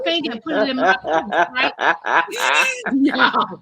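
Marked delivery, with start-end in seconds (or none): 1.8-2.9 high, giggling laugh; a giggle breaking into the telling. Real, not acted. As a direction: laugh at your own story. Laughing mid story.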